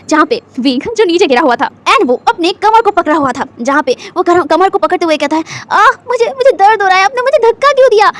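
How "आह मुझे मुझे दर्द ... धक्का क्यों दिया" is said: high, whimpering complaint of pain; whiny fake crying. Wing whimpers to Lan Wang Ji after falling, fake crying. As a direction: fake sobbing to win sympathy. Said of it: "वो एकदम रोते हुए voice में कह रहा था".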